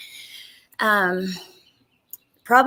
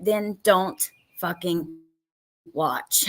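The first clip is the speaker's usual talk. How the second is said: frustrated emphasis; 2 semitones lower, much slower than usual. Real, not acted. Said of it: "I'm really frustrated".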